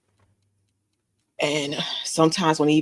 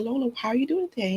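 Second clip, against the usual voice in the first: higher, softer, quick sing-song greeting; sweet gentle voice; 8 semitones higher, 4 dB quieter, faster than usual. Shown as a demonstration. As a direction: coo "Lola" sweetly like to a baby. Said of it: "Watch this, honey".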